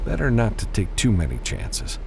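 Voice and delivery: plain reading voice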